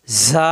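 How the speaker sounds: ঝা opened with a long buzzing z; whistling sibilant. Shown as a demonstration. Teacher pointing out the escaping air, whistling sibilant. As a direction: let air stream out through ঝা. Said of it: "বাতাস বের হচ্ছে".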